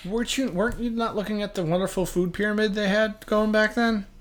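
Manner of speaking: ordinary talk, nothing marked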